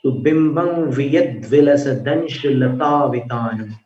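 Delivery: ordinary talk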